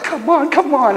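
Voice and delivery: retold impatient nagging; impatient urging. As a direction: hurry a dawdler along, exasperated. Impatient retold urging of dawdling friend.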